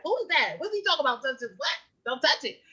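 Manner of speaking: high, puzzled mock confusion; a parent hearing a child's odd words, baffled; baffled bystander. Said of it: "I'd be like".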